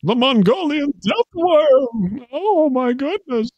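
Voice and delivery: high-pitched cartoon hero, drawn out; Dudley Do-Right. Podcaster demonstrates a worm hero character, hammed-up cartoon hero voice. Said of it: "like a Dudley Do-Right type voice".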